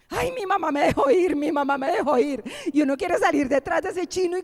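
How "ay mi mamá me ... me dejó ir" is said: put-on gleeful child. Child rejoicing at permission.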